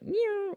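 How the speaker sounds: very high-pitched "nyow" zap noise; mouth-made lightning sound, very high; imitated lightning zap.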